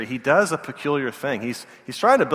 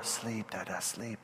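put-on much softer lulling toddler voice; soothing toddler; 3 semitones lower, 16 dB quieter, slower than usual. Toddler pets dad's head, soft and hushing. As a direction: hush dad back to sleep.

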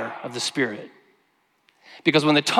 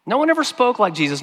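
raised, quick, marvelling delivery; excited praise; 7 semitones higher, faster than usual. Real, not acted. Preacher marvels to the church, excited and fast. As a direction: lift it up fast with wonder. Preacher exults over Jesus' words.

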